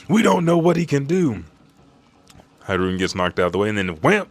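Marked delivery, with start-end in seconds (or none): 0.1-1.4 high-pitched anxious character voice; urgent warning. Retold or imitated. True friend warns about the enemy, urgent.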